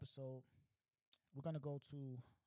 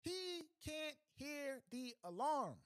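high, loud, slow disbelief; incredulous; 12 semitones higher, 6 dB louder, much slower than usual. Acted. Comedian slowly stressing the robber can't hear the alarm. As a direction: stretch it out in amazed disbelief.

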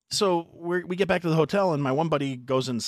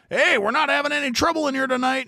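high, firm bartender voice; bartender warning; 5 dB louder than usual. Retold or imitated. Bartender warning the rowdy group, raised high and firm. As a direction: warn them off, pitched high. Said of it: "It's like".

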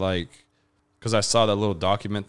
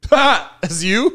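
loud, much higher mock scoff; mocking laugh at a fan; 10 semitones higher, 8 dB louder, much slower than usual. Meeting a Twitter troll in person, loud, higher mocking jeer. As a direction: jeer like you just caught the nerd out. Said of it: "I'm gonna have a hard time not being like".

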